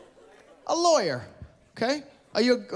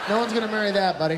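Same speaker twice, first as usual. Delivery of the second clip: normal talking voice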